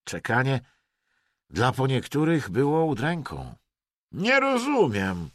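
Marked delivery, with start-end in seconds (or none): 4.1-5.3 very high, drawn-out resentment; hateful hissing. A narrator's reading. Vadim grumbles in the rain, full of hate. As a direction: force it out hatefully, high and slow. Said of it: "świszczał nienawistnie Wadim".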